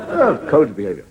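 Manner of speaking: usual speaking voice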